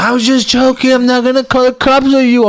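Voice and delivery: high, innocent mock girlfriend voice; mimicking girlfriend. Boyfriend mimics girlfriend taking back her threat, in a high mock voice. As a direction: squeak out her backpedaling.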